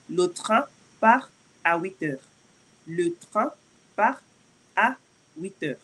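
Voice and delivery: "Le train part à huit heures" broken into pieces; teacher splits "Le train part à huit heures" word by word; word by word French. Shown as a demonstration.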